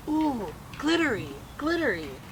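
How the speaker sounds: put-on high little-boy coo over the nails. A narrator's reading. Casey, the little brother, cooing at glittery nails. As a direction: marvel at it like a small child. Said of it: "he said".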